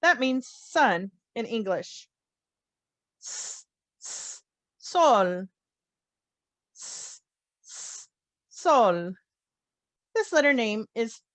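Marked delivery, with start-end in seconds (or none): 3.2-4.4 "S, s" as two soft separate hisses; letter sound. Shown as a demonstration. Teacher drilling the S before sol, two hisses. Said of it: "It sounds like S". 6.8-8.1 "S, s" as two soft separate hisses; letter sound. Shown as a demonstration. Teacher drilling the S before sol, two hisses. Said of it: "It sounds like S".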